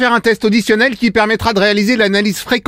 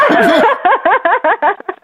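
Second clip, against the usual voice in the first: hearty laugh; 11 semitones higher than usual. Real, not acted. Prank revealed, caller laughs.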